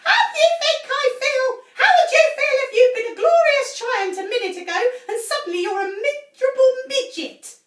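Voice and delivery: high, loud, fast furious rant. A narrator's reading. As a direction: rage at them all. Furious tiny grandma.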